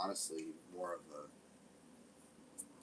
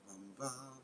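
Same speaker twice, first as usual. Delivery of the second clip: mock guitar strum; 6 semitones higher, 6 dB quieter than usual. Plotting a mocking Toby song, hummed guitar strums. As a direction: hum it like a lazy guitar intro. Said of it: "we're just gonna sit there and be like".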